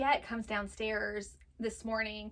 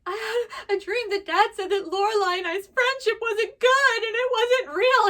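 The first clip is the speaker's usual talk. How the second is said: put-on high, louder, slowing child's wail; upset child; 11 semitones higher, 8 dB louder than usual. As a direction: imitate a distraught little girl. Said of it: "Juliet's like".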